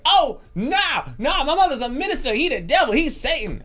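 very high, loud hammed-up shriek; mock hysterical falsetto yelling; imitated screaming. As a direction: imitate a panicked woman screaming in protest. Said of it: "Lady was screaming".

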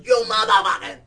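very loud, high shouted curse; imitated stranger roaring back; retold escalating yell. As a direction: top him with a bigger shout. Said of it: "we keep going, Dush, dush".

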